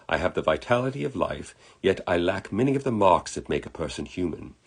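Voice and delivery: put-on British accent, lifting at phrase starts; hammy British gentleman explaining himself; terrible British accent. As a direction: reassure and explain as an amateur-accented Englishman. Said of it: "I do a terrible British accent".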